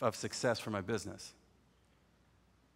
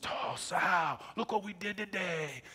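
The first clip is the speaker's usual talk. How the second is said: higher, excited cousin voice; Kenny excited; 7 semitones higher, slower than usual. Retold or imitated. Cousin Kenny after a successful auction, excited higher voice. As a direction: gush like a proud buddy. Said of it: "and he says".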